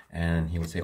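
ordinary delivery

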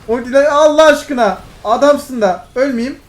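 high, loud desperate begging; frantic plea. Real, not acted. Streamer begging teammate for his ult, frantic. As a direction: beg for the ult as the fight turns.

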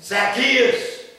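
loud high call up into a tree. Acted. Jesus calling up to Zacchaeus in the tree, a loud call. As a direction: call his name out across the crowd.

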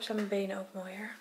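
quick hushed whisper. Real, not acted. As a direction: drop to a whisper. Aside to camera.

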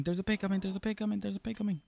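high, soft, urgent warning. Acted. Repeated warning, higher and softer.

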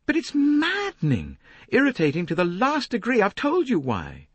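high-pitched, heated protest; loud, very high irritated lady; exasperated outburst. Acted. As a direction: burst out in exasperation. Lynette snaps back at Poirot.

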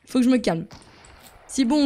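ordinary talk, nothing marked

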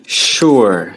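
"sure" with a full, firm sh; heavy SH sound. Shown as a demonstration. Teacher giving the answer, heavy SH. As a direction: start "sure" on a weighty "sh". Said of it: "Đọc rất là nặng".

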